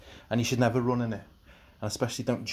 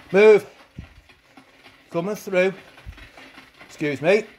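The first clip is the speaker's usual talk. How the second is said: shouting; 5 semitones higher, 6 dB louder than usual. Pretend. Overtaking slow joggers in game.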